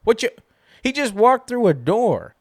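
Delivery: high, loud incredulous outburst; like you can't believe what you just saw on screen; exasperated disbelief. Retold or imitated. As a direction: throw up your hands, high-pitched and loud. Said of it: "and I go".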